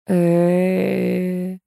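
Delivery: long level hesitating "e-e-eh"; drawn-out. A narrator's reading. Old woman tries to recall the station, drawling. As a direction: stretch one sound while trying to remember. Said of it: "протянула старуха".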